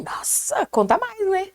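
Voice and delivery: high, airy mock amazement; mock surprise. Woman retelling her reply to a compliment, in mock surprise.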